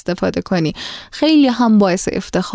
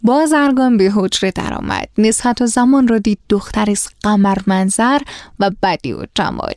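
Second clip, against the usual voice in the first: ordinary delivery, nothing marked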